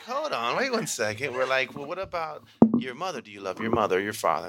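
put-on high, coaxing voice to children; high playful questioning; playful teacher to kids. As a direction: tease the kids into answering.

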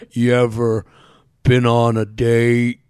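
slow, low, dragged-out talk; half speed. Shown as a demonstration. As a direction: talk like slowed-down playback. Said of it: "You're gonna go to half speed".

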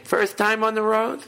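high-pitched, slow comedian impression; put-on high voice, drawing the question out; Jay Leno. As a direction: imitate a comic asking a newcomer, high and slow. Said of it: "he was saying about Jay Leno".

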